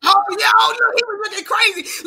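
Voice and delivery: excited. Real, not acted. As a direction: burst in excitedly. Host excited on call.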